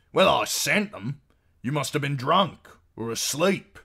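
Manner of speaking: high, loud accusation; annoyed husband. A narrator's reading. Husband blaming the outlaws, loud high annoyed voice.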